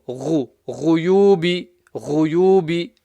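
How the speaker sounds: GHU, GHUYUBI from the top of the throat; guttural ghain. Shown as a demonstration. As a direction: make the gh in GHUYUBI clear and guttural. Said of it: "guttural sound".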